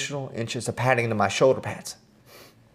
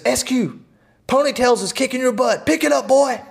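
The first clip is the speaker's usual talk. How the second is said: drill sergeant taunt; 11 semitones higher than usual. Retold or imitated. Drill sergeant taunting the lagging guy in class, high mock yell. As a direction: needle the slacker like a drill instructor.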